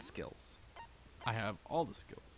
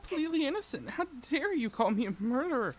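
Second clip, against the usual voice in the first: indignant high voice; 8 dB louder than usual. Acted. Voicing character's defence.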